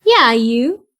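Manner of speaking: put-on a friendly little kid. Child Janice answers Sapala.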